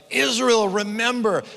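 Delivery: high, loud calling out; prophet's cry. Acted. Prophets calling to Israel, loud and high. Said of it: "The prophets are constantly like".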